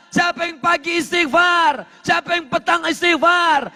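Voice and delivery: high-pitched, driving sermon delivery; full-force preaching. Real, not acted. Preacher urging congregation to seek forgiveness, full-force preaching. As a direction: hammer out each repeated phrase high and insistently.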